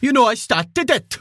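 angry South Walian. Retold or imitated. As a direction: snarl it out in South Welsh. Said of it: "Angry South Walian".

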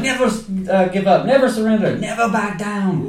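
lightly imitating a German accent; mock accent. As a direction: clip each word like a German movie fighter. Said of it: "he does it with an accent".

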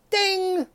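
high sung ding; mock game show ding; ding chime. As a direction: imitate the correct-answer bell.

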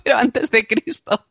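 high voice broken up by laughter; laughing. Real, not acted. Linguist answering co-host mid-giggle, laughing. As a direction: struggle to get it out through giggles. Cracking up at co-host's question.

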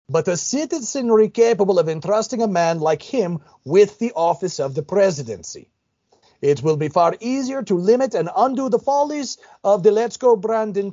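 a lightly put-on Ukrainian accent with solemn emphasis. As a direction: read the quote with a hint of a Ukrainian accent. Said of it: "So that was a Ukrainian accent".

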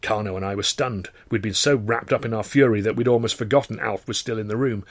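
plain reading voice

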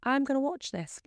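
a lighthearted crush on a film hunk; mock swooning. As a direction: gush over the actor in jest. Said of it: "and I'm like".

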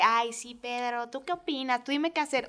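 mock the girlfriend who asks him everything; wife mocks the meek girlfriend she says she isn't, soft and meek; mock submissive girlfriend. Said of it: "tampoco soy este rollo tan femenina".

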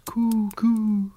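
breathy whispered pigeon coo. A narrator's reading. Wood pigeon closes its tale with a whispered coo. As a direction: let the coo fade to a whisper.

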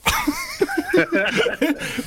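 high-pitched burst of laughter; laughing. Real, not acted. Host cracks up at the Michael Caine mix-up, high laugh.

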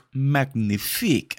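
slow, drawn-out fake French; mock French. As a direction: kiss it off like a chef. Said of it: "chef's kiss".

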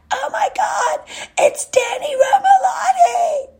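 very high, ear-piercing squeal of a starstruck fan, laughing; excited shriek. Retold or imitated. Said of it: "gets a super high pitch".

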